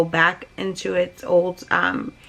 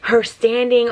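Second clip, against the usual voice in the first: ordinary delivery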